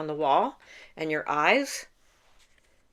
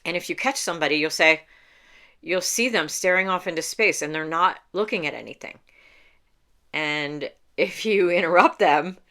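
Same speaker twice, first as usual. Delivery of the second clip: ordinary talk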